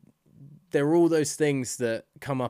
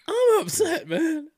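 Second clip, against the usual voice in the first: mock an emotional speech that cracks; cracking voice; 8 dB louder, slower than usual. Mocking the coach's speech, voice cracking high and loud. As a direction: voice cracks much higher and louder. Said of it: "His voice cracking during that speech".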